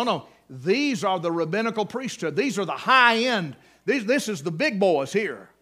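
usual speaking voice

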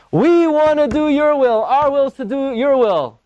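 high, pleading prayer voice; earnest plea. Retold or imitated. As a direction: plead it sincerely to God. Translating a heartfelt prayer.

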